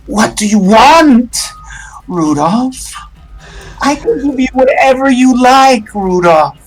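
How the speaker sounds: high, coaxing spirit voice; pleading spirit. Acted. Spirit coaxing Rudolph to stop chanting, high and pleading. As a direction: tempt him sweetly to stop. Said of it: "it says, P".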